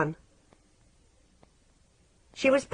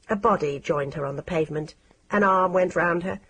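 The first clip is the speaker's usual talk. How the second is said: ordinary delivery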